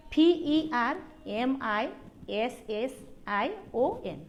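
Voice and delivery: P E R M I S S I O N one letter at a time; spelling out. Shown as a demonstration. Teacher asks class to spell along, spelling out. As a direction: spell out P E R M I S S I O N for students to follow. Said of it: "Now spell with me".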